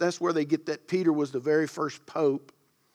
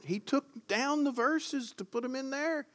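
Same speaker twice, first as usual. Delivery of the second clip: high-pitched, softer incredulous protest; mock disbelief; 9 semitones higher, 6 dB quieter than usual. Real, not acted. Preacher ribbing his verse operator over slides, mock disbelief. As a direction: squeak out a joking complaint, pitch way up.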